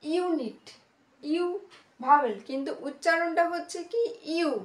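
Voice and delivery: normal talking voice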